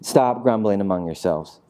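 low, measured telling-off; weary rebuke. Acted. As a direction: drop low and scold evenly. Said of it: "So Jesus is like".

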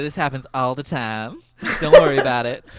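loud, breezy small-town Southern clerk; general store woman accent. Retold or imitated. Store clerk tells the caller not to worry, Southern accent. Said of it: "synchronized in this accent".

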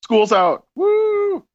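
loud whooping celebration; excited cheer. Real, not acted. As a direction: cheer like school just let out. Cheering that summer is here.

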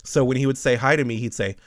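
usual speaking voice

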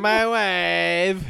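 hammed-up foreign accent voice; Borat impression. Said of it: "That's really what he's doing".

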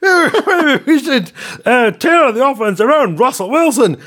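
laughing, squeaky mock executive; Kermit-like executive. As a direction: dither in a silly frog voice. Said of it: "I thought Kermit had joined".

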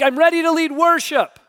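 cheerful high boast of a novice; eager beginner. Retold or imitated. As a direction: sound naively overconfident. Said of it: "you're like".

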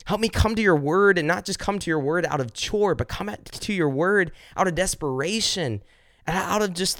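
high, pleading prayer that builds toward a cry; impassioned pleading prayer. Acted. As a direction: pour it out to God, begging.

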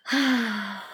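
long, breathy acted sigh; deep sigh. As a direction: heave a deep, weary sigh. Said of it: "The Mock Turtle sighed deeply".

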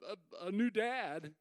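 ordinary talk

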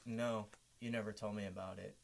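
low, quiet, lightly voiced clueless designer; mock designer. Host quietly imitating the clueless designer's excuses. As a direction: mumble it like she doesn't care.

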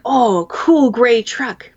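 slow, high, sing-song coaxing; grandma talking up the truck, sugary coaxing. Retold or imitated. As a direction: coax a toddler like a sweet grandma. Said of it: "even Grandma has tried to".

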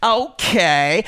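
low, sneering weasel taunt; enemy voice. Acted. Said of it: "use the enemy voice".

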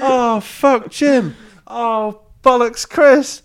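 high, loud, slow wail of a game guard; NPC discovering bodies. Retold or imitated. Guard NPC finding every body in the room, wailing high and loud. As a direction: cry out each name as a guard stumbling on the dead. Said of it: "do his sort of discover body routine".

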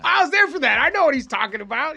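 high, loud blurting; imitated heckling friend. Friend in the audience interrupting his story, mock eager blurting.

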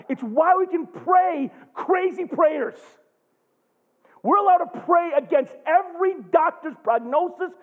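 high, loud fervent preaching; full-force preaching. Real, not acted. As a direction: preach it with all you've got.

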